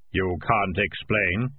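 slow, hollow voice of Death; low and measured, like Death himself; Death's voice. A narrator's reading. Death speaks, slow deep.

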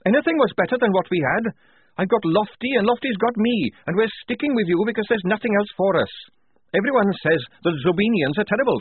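high, loud, furious rant; heated. A narrator's reading. Tonka red in the face over bullies, ranting. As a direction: pour it out angrily, pitch way up. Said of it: "red in the face".

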